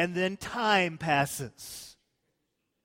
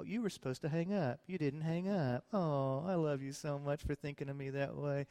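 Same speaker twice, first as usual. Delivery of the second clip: soft, sweet lovey-dovey phone talk; giggly late night call; 6 dB quieter than usual. Retold or imitated. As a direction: coo like a smitten young couple. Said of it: "lovers on phone late night".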